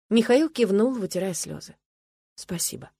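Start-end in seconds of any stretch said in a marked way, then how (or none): 2.4-2.9 soft, understated murmur; whispered thanks. A narrator's reading. Husband wiping tears thanks his wife, soft near-whisper. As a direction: say it under the breath, lightly. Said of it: "прошептал он".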